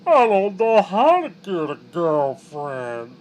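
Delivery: slow, loud, high slug voice; slow slurpy slug. Retold or imitated. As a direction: drag the words out like a sad slug. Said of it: "sounding like that slug from that one Adventure Time episode".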